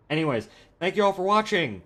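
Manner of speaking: high, loud and cheery sign-off; upbeat outro. Real, not acted. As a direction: wrap up the video with a big grin.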